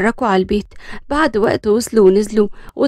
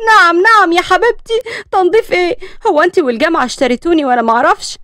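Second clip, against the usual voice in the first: higher, louder mock outrage; indignant outburst; 7 semitones higher, 4 dB louder than usual. Daughter outraged at her mother's cleaning orders, mock indignant. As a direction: protest the chores in disbelief.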